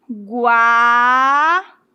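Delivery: "Guaíba" with a long, swelling gua, then í and ba apart; exaggeratedly slow "Guaíba", syllable by syllable; drawn-out syllable demonstration. Shown as a demonstration. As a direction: stretch "Guaíba" very slowly, pausing between syllables. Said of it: "É como se eu falasse assim".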